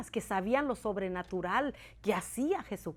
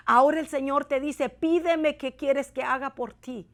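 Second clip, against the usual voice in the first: loud, higher, driving sermon voice; preaching at full force; 5 semitones higher, 8 dB louder than usual. Acted. Preacher proclaiming Jesus' offer to viewers, fervent loud preaching. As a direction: lean in and proclaim every line.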